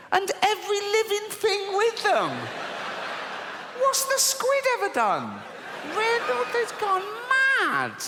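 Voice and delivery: high-pitched disbelief, voice jumping way up; incredulous outrage. Real, not acted. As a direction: squeak out the disbelief. Incredulous reaction to flood plan.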